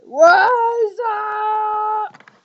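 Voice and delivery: loud shouted what's up; shouted greeting. Acted. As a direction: yell it at the camera.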